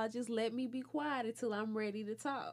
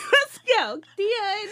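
mom calling out; 7 semitones higher, 9 dB louder, much slower than usual. Retold or imitated. Mom calling son, leaving angrily.

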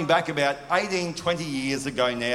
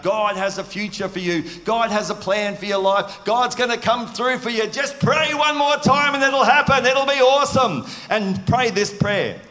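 much higher, louder hammed-up cheerleader gush; mock hype; 8 semitones higher, 5 dB louder than usual. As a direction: parody the over-the-top devotional. Mocking an overhyped devotional.

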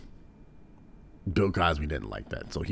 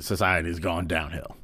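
ordinary delivery

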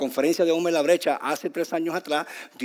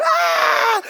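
put-on very high, loud child's wail; imitated child's scream; 10 dB louder than usual. Preacher imitating a child screaming for its parents, shrill wail. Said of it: "tu niño comienza a gritar".

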